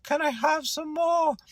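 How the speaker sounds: high, plaintive mock orphan begging; old-timey movie pauper. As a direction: beg like a poor waif in an old film. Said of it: "like, old-timey movies".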